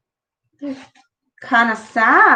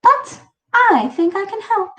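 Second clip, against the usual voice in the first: put-on cheerful, higher old lady; bright old woman; 10 semitones higher than usual. A narrator's reading. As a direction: chirp it sweetly. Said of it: "she said brightly".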